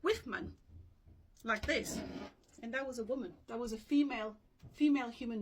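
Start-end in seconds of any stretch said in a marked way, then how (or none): 0.0-0.5 WIFMAN said as wif plus man; Old English word. Shown as a demonstration. Teacher presenting the old word for woman, pronounced wif-man. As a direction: say WIFMAN in two clear parts, wif-man. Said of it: "in Old English, was called".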